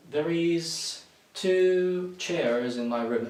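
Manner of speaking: slow, loud "There is two chairs"; slow mistake. Shown as a demonstration. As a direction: stretch out the wrong version. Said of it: "Oh, I mean".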